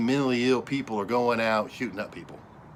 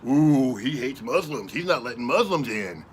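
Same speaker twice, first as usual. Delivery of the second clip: mocking the media. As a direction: parody their outrage, dragged out. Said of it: "the media spins it and calls it".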